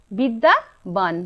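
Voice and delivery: বিদ্যাবান stretched slowly, syllable by syllable; drawn-out answer. Shown as a demonstration. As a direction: let the class hear every syllable of বিদ্যাবান. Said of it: "teacher stretching the answer".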